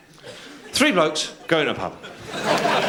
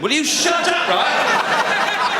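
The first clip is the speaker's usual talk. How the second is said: very high-pitched scolding; hammed-up furious squawk; exasperated outburst; 4 dB louder, much slower than usual. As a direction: lose patience with a heckler. Snapping at the heckler.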